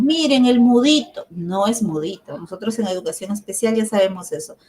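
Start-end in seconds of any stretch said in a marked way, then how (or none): 0.0-1.3 pitying remark. Retold or imitated. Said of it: "A veces decimos".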